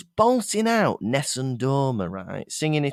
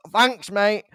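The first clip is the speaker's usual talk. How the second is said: higher, matey Mancunian aside; Manchester accent; 6 semitones higher, 3 dB louder than usual. Retold or imitated. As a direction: break off singing for a quick Manchester thanks. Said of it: "in a Manchester voice".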